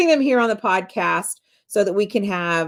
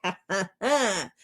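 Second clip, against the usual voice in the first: smug laugh; 4 dB quieter than usual. Retold or imitated. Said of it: "they're all smug".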